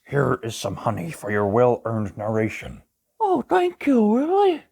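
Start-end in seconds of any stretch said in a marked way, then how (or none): 3.2-4.6 gentle cartoon bear narrating; Pooh Bear voice. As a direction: read it as a gentle honey-loving bear. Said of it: "Pooh Bear, for reading".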